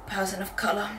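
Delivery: hushed whisper; a whispered "person of colour"; whispered aside. Real, not acted. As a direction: drop to a confiding whisper. Whispered aside about being only one.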